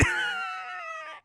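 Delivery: high, shrieking laugh; burst of laughter. Real, not acted. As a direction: crack up at the scene. Laughing at the retold scene.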